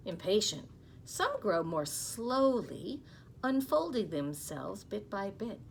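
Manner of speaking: storybook reading voice, lively; gentle read-aloud to young listeners; reading to children. Acted. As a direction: read it to the class like a picture book. Teacher reads picture book aloud.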